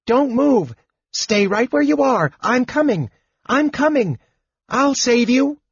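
very high, excited little rescuer; Tasselhoff the kender. A narrator's reading. Kender rushes out to save his friends, high excited calling. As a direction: pitch it way up, eager and urgent. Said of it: "A voice answered".

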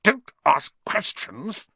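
high, drawn-out angry order; irritated, emphatic rebuke; snapped. A narrator's reading. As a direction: snap at the boy word by word. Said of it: "snapped his uncle".

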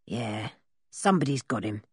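surly villain's reply; gruff captor. A narrator's reading.

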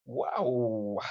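usual speaking voice

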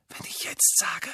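unvoiced whispered aside; whispering. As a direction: drop to a sly whisper. Aside within Malfoy quote.

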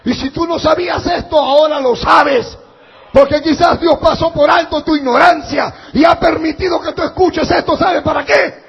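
loud, high, driving sermon shout; preaching at full force. Acted. Preacher warns the congregation against sin, full-force preaching shout. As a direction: thunder the warning at the congregation.

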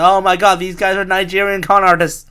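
high, loud mock outrage; mock alarm. Mimicking Americans accusing space con artists, in loud mock alarm. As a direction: cry it out like a scandalized accuser.

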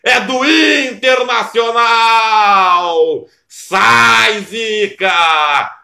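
drawn-out goal cry; goal shout. Real, not acted. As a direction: roar the goal call. Goal call on radio.

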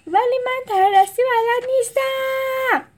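high, loud, pleading voice; frightened protest. A narrator's reading. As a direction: beg with rising fear. (اعتراض ترسیده با صدای زیر)

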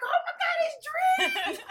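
high-pitched, laughing mock squeal; fangirl squeal. Reenacting seeing Dream at the tunnel, hammed-up fangirl squeal. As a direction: squeal it as if your idols just appeared.

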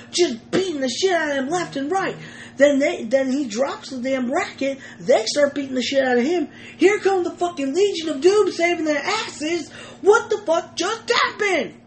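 high, fast, amazed retelling; excited retelling. Retold or imitated. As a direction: tell it like you're still buzzing.

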